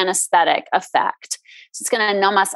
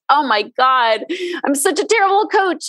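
pitched way up, melodramatic self-blame; mock despair; 4 semitones higher than usual. Coach caught eating Doritos, mock despairing wail. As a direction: ham up the guilty wail. Coach mocking her own guilt.

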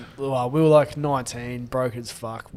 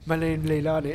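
lightly voiced Islander lady, giggling; a hint of Islander accent; Leilani; 2 semitones higher than usual. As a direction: play the beautiful girl with a laugh. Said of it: "Let's call her Leilani".

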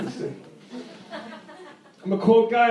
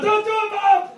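high, louder mock panic yelling to mom; mock frantic plea; 11 semitones higher, 3 dB louder than usual. Comic begging mom not to do it, high mock panic. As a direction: cry out in hammed-up alarm. Pleading with suicidal-texting mom.

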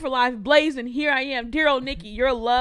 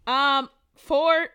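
usual speaking voice

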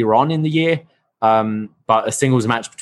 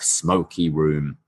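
ordinary delivery

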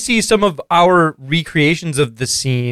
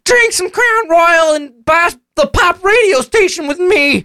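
put-on much higher dopey man-child voice; Ralph voice; 11 semitones higher than usual. [name] as Ralph, brushing off his dad in a high dopey voice. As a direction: whine it like a clueless slacker. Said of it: "my Ralph voice".

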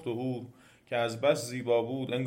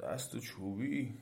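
very soft, slow, sleepy question; drowsy demon; 9 dB quieter, much slower than usual. A narrator's reading. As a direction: ask it barely awake, slow and soft. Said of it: "خواب و بیدار گفت".

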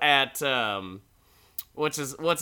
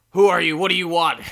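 grumpy snap; 6 semitones higher, 8 dB louder, faster than usual. Retold or imitated. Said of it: "He's like".

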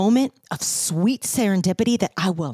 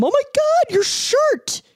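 "Oh my God, your shirt" squealed very high and louder; excited gasp; 4 dB louder than usual. Retold or imitated. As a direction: quote it with a squeal of sudden delight. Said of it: "I stopped and I said to him".

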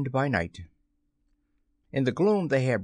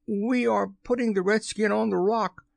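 high-pitched call across the water; pirate's high hail; 11 semitones higher than usual. A narrator's reading. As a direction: call it out high to someone far off. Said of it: "Smee called out".

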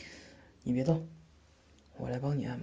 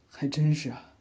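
louder, amused disbelief; amused surprise; 2 semitones higher, 6 dB louder than usual. Acted. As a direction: laugh off her clumsiness. Her fall on flat ground confirmed.

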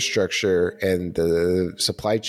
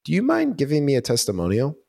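higher-pitched seller asking a favor; polite request; 7 semitones higher than usual. Acted. Seller asking a customer for a testimonial, polite and high. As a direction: lift the voice into a tentative ask.

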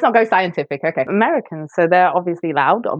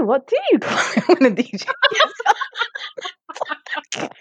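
breaking into giggles mid-line; dissolving into laughter, fading to a whisper; laughing through it; 8 semitones higher than usual. Real, not acted. S1 repeats it, laughing.

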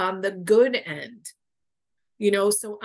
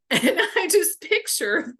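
laughing; 9 semitones higher, 5 dB louder than usual. Real, not acted. Giggling while telling a funny story.

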